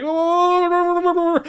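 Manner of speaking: high, loud wobbling wail; mock a panicked, cheek-shaking howl; cartoonish terrified "ohhh"; freak-out wail. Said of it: "he starts shaking his cheeks".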